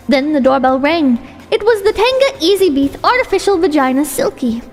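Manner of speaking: plain reading voice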